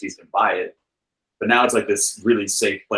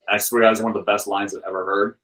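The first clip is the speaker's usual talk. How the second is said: usual speaking voice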